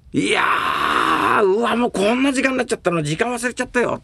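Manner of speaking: stretched-out mock surprise at the hour; astonished audience member. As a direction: gasp that the hours flew by. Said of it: "っていう芸".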